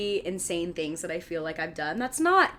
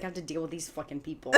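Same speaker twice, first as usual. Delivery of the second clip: a quick, softer, lightly grumbling man; grumpy neighbour; 4 semitones lower, 9 dB quieter, faster than usual. Retold or imitated. Imitating the grumpy neighbour's thoughts, a light fast grumble. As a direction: rattle off his complaint under your breath. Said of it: "he's like".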